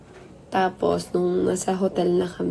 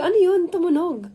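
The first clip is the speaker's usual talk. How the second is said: surprised; 9 semitones higher than usual. Real, not acted. Startled by a sound.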